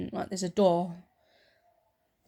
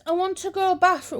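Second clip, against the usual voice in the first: put-on higher, lightly childish excuse; 10 semitones higher than usual. Young self fibbing to mum, high childish. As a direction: fib like a small child. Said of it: "And I said".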